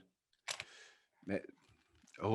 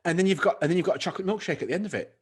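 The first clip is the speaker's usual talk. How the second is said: ordinary delivery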